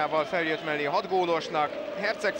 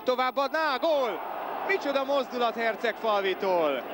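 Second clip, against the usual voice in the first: excited sports commentary; 6 semitones higher than usual. Real, not acted. Commentator calls a goal.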